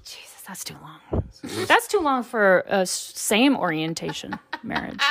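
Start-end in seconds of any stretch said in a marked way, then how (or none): 0.0-1.2 whispered aside. Real, not acted. Host whispering about the eleven-year marriage. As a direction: breathe it under your breath.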